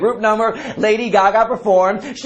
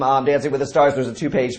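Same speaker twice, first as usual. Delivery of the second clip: ordinary talk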